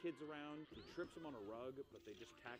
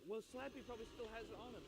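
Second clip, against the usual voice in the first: mock kid voice; 11 semitones higher than usual. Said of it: "They're like".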